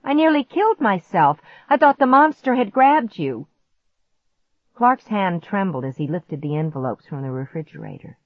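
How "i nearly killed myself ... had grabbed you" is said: high, exasperated outburst; indignant shriek. A narrator's reading. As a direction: scold him at high pitch. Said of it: "I shrieked".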